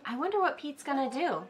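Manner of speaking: usual speaking voice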